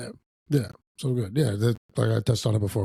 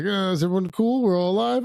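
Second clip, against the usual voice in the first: mock checking in; 10 semitones higher, 3 dB louder than usual. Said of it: "be like".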